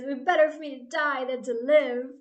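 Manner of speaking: loud, hammed-up despairing wail; melodramatic Jonah. Jonah moaning to God he'd rather die, hammed-up loud melodrama. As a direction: overplay it like a sulking prophet. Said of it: "Jonah is full drama at this point".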